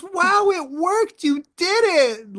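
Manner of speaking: very high, slow, gushing mock praise; exaggerated sing-song amazement, drawn right out; praising a little kid. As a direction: imitate someone cheering on a toddler. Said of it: "She acted like I was a little kid".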